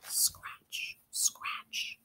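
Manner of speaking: breathy scratch sound effect; whispered scratching. Acted. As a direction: whisper it like claws on wood. Scratching at the door.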